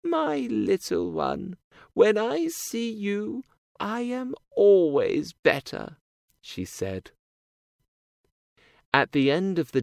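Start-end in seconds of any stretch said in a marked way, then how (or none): 0.0-6.0 higher, slow loving granny; grandma voice. A narrator's reading. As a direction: voice the old grandma.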